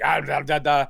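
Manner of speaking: a hint of an angry mummy's gibberish; mock ancient Egyptian. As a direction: babble fake Egyptian syllables, half-hearted menace. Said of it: "Bubba Hotep now is angry, so he speaks".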